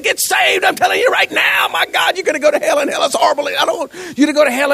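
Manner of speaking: high-pitched, frantic pleading shout; hammed-up hellfire plea, shouted high; mock impassioned preaching. Preacher acting out shaking the audience, mock frantic shouting. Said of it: "he's just preaching with such passion".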